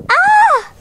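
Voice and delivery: short, very high playful squeal. Pretend. Toy finds bouncies, high squeal.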